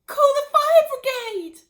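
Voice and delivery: high, loud, slow panicked cry; cried out. Acted. Missus Cranky crying out as her mother steams. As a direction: scream for help in a panic. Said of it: "cried Missus Cranky".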